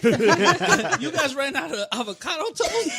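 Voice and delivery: bougie customer. Retold or imitated. Mocking a customer whose avocado toast ran out, slow and high. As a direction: stretch it out like an entitled diner.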